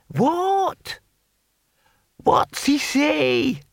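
put-on very high, drawn-out querulous old voice; high-pitched, slow, baffled old codger; hard-of-hearing old man. A narrator's reading. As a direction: pipe it up like a deaf old-timer. Deaf old Hamish asks what was said.

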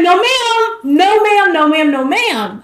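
high and loud, fading off; alarmed refusal. Retold or imitated. Refusing the danger she's in, high and loud then fading. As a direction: blurt it in alarm then calm down.

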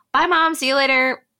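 put-on breezy child calling bye to mom; carefree kids' goodbye. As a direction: imitate kids happily dismissing mom. Voicing carefree kids saying bye.

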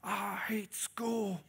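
hammed-up imitation of his son's tantrum; angry eleven-year-old. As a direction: play a furious little boy who hates tests. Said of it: "he'll be like".

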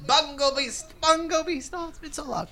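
very high goblin squeal; excited goblin. Acted. Blue goblin answers his old friend, high excited squeal. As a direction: squeal back in a high, thrilled voice. Goblin echoes the happy shout.

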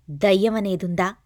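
asked loud and forceful; loud question. A narrator's reading. Detective pressing the cult leader, loud demanding question. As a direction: demand it loudly, pressing hard. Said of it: "గట్టిగా అడిగాడు".

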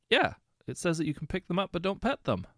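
mock-confident blunder; confident misreading. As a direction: rattle off the wrong reading proudly. Guy misreads the sign aloud.

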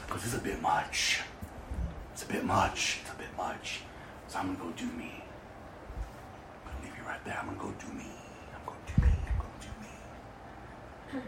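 fading to a near-whisper as the thought drifts off; half-whispered, letting each repeat drop away; trailing hush. Retold or imitated. Preacher retelling turning her back on God, a fading whisper.

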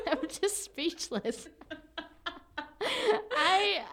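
slow words broken by real laughter; laughing, speechless. Real, not acted. Reacting to the odd Reddit post, laughing. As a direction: laugh your way through, barely getting words out.

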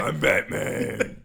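loud, slow, low vigilante rasp; Batman. Acted. Guest correcting the host, growled Batman voice. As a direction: growl it out hard and long. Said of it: "clear your throat".

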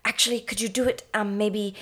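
soft, halting little British director; stammering director. Retold or imitated. Director meekly asking Orson for another take, stammering. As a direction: timidly hem and haw through the request. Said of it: "stammering, like, weird little director guy".

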